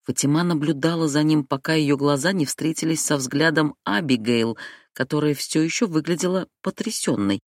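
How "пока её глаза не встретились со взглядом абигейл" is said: plain reading voice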